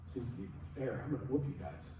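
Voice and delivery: lightly voiced boxer's quiet trash talk; cocky fighter taunt. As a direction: mutter a boxer's taunt under your breath. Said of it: "He goes".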